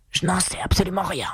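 grumpy put-on beast voice; grumbling lion. A narrator's reading. Lion brushing off the king, grumbling. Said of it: "grommela le lion".

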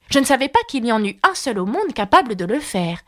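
usual speaking voice

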